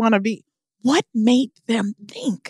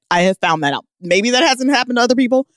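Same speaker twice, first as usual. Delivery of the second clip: ordinary delivery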